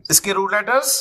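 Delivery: normal talking voice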